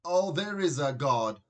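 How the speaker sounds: loud, heartfelt outburst of relief; father exclaiming in relief that his son won't be a plumber; relieved exclamation. A narrator's reading. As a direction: thank heaven out loud. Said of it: "exclaimed Dad".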